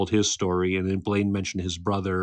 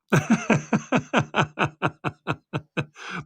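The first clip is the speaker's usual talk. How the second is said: rising laugh; 8 semitones higher than usual. Real, not acted. Host laughs at own joke.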